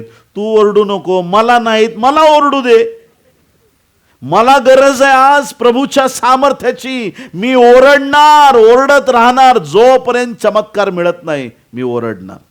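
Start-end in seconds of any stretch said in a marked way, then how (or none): 0.0-7.1 loud, high outcry that keeps building; full-voiced, insistent plea; desperate acted shouting. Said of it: "बर्तिमय ओरडत होता". 7.3-8.6 very loud, high, drawn-out yell; stretched-out cry at the top of the voice; defiant full shout. Acted. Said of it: "बर्तिमय ओरडत होता". 8.6-11.5 loud, high, insistent cry; impassioned full-voiced outcry; defiant shouting. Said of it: "बर्तिमय ओरडत होता".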